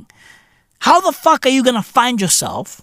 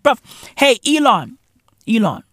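ordinary talk

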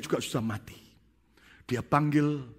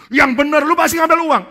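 pushy shout; 14 semitones higher, 14 dB louder than usual. Acted. Two pastors pressing the third to confess, loud high badgering. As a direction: drag the confession out of him.

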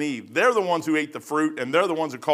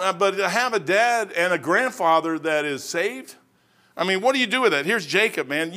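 ordinary talk, nothing marked

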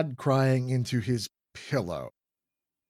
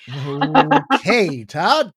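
loud, higher announcement; announcing; 5 semitones higher, 11 dB louder, much slower than usual. Acted. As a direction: announce it firmly. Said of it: "you announce".

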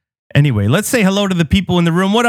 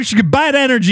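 ordinary talk, nothing marked

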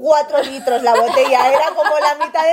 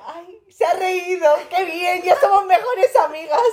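ilusión imitada; 5 semitones higher, slower than usual. Retold or imitated. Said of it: "Y yo dije".

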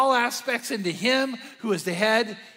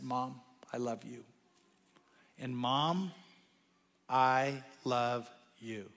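much softer, slow "I love you"; tender daughter's confession; 7 semitones lower, 10 dB quieter, slower than usual. Retold or imitated. Stepdaughter telling her mom at last, soft and tender. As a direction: say it gently, like a breakthrough.

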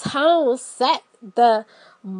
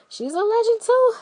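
high excited praise; 11 semitones higher, 5 dB louder than usual. Acted. Praising singer, voice jumps high.

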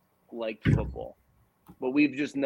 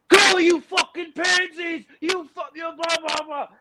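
high, loud, hammed-up fan yell; wife screaming at football; 5 dB louder than usual. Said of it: "and she's screaming".

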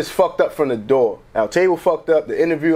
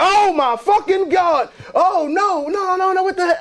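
high, shouted mock panic; shocked outcry; 14 semitones higher, slower than usual. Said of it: "they was like".

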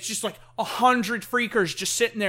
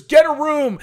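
higher, much louder jeering shout; mock call-out; 6 semitones higher, 11 dB louder than usual. Groom tells heckling friend off, mock loud call-out.

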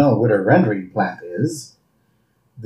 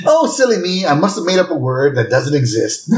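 Burns's sarcastic cut-in; 3 semitones higher than usual. Acted. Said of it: "And Burns cuts him off".

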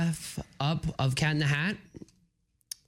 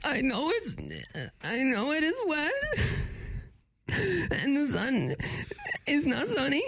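put-on old lady voice; 11 semitones higher than usual. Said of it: "I wanna try Grandma and Grandpa".

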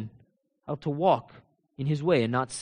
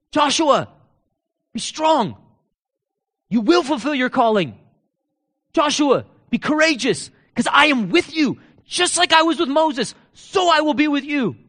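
higher, louder preaching as God's voice; preaching at full force; 8 semitones higher, 5 dB louder than usual. Acted. God speaking promises over Joshua, preached loud. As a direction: proclaim it over him like a promise.

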